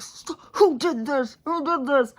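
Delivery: high, loud, outraged scolding; yelling at them. Retold or imitated. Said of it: "yelling at them".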